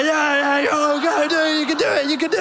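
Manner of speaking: high excited cheer egging him on. Retold or imitated. Passengers cheering the escaping guy on, high and excited. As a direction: chant encouragement from the crowd.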